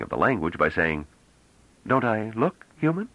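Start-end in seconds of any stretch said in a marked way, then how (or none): 1.9-3.1 put-on a young woman pitched high, slow and careful with the words; hesitant young woman. Young woman asks the men, slow and high. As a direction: play the stranger testing the language.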